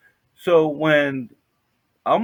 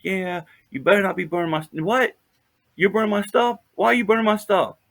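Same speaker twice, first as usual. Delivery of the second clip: higher, somewhat louder mock holler; retold hollering; 7 semitones higher, 4 dB louder than usual. Woman from homeless camp hollering into the woods, mock holler. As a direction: imitate her calling out accusingly. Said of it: "hearing her hollering to the woods".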